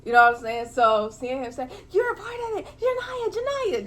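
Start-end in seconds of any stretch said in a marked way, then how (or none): 1.9-3.9 high, soft mimicking of the accuser; mock accuser. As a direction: play him calling her out, high and thin. Mimics man accusing Janiyah.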